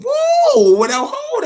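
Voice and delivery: sudden very high yelp; surprised whoa. Real, not acted. Fan reacting to Garcia's win, sudden high whoa. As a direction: jump up in disbelief.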